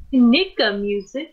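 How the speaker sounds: slow, drawn-out "Nika music" with a rising tune; odd pronunciation. Retold or imitated. Mimicking the girl saying "Nika music" slowly, questioning. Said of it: "she just like".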